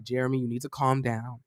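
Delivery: a lightly voiced ex talking him down gently; calming ex. Ex calmly talks him down.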